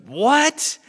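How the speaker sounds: high, loud startled what; shocked what. Retold or imitated. Husband hearing his wife's skydiving plan, high startled disbelief. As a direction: leap up in disbelief. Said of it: "He goes".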